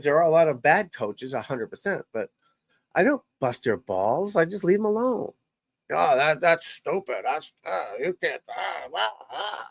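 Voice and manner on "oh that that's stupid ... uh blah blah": slow, whiny-high imitation of a hater; mocking critics. Coach imitating online critics, mocking. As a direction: drag it out like a dopey heckler, trailing into blah blah.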